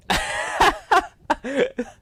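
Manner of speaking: high, loud burst of laughter; laughing. Real, not acted. Bursts out laughing at camera translation.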